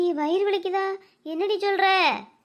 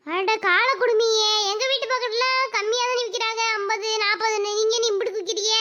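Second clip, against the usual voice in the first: high, indignant bargaining; haggling protest; 5 semitones higher than usual. Acted. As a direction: protest the price in a raised pitch. Customer protests coconut seller's price. (விலையை எதிர்த்து உயர்ந்த குரலில் அங்கலாய்ப்பு)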